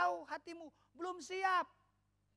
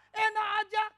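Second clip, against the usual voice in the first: louder, higher indignant retort; mock indignant; 5 semitones higher, 9 dB louder than usual. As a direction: huff it like an offended churchgoer. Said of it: "seringkali kita berkata".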